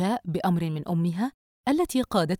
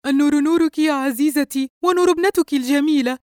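high, loud gushing guest; effusive compliment; 8 semitones higher, 8 dB louder than usual. A narrator's reading. Guest returning hostess's welcome with compliments, effusive. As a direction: lay the flattery on loudly.

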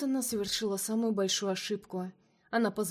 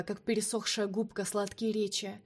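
ordinary delivery, nothing marked

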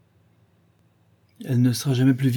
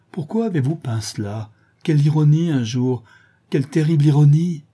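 higher, louder lament, building; anguished outburst; 5 semitones higher, 5 dB louder than usual. A narrator's reading. Young man lamenting his painted portrait, in an anguished cry. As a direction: cry out at the bitter irony.

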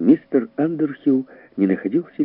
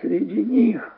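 higher-pitched cry of despair; desperate exclamation; 7 semitones higher, much slower than usual. A narrator's reading. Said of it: "воскликнул в отчаянии".

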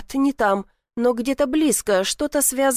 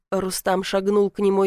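plain reading voice

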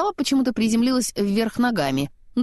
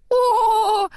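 high, loud, drawn-out "oh"; exclaiming; 14 semitones higher, 5 dB louder than usual. A narrator's reading. Snowman reacting to the carrot, excited exclamation. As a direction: burst out with a long high cry. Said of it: "воскликнул он".

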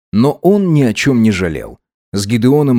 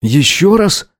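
higher, startled question; taken aback; 7 semitones higher, slower than usual. A narrator's reading. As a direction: ask it as if caught off guard. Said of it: "опешил Гидеон". (ошарашенно)

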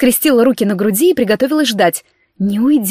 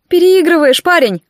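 higher, louder mocking remark; snorting mockery; 8 semitones higher, 5 dB louder than usual. A narrator's reading. Boss calls out captive's overacting, mocking. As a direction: tease the bad actor. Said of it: "хмыкнула моя начальница".

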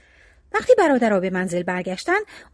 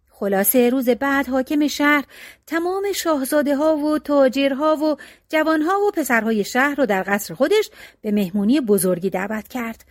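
ordinary delivery, nothing marked

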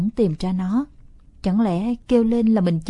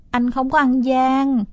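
sweet-talking little sister; 4 semitones higher, 3 dB louder, slower than usual. Acted. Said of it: "Tiểu Ly làm quà".

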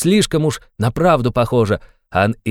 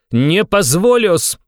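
slow, higher, louder roar of refusal; shouted with full force; 6 semitones higher, 4 dB louder, much slower than usual. A narrator's reading. Raskolnikov to Porfiry, shouting with all his might. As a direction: bellow it out as the fist hits the table. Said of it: "крикнул он вдруг изо всей силы".

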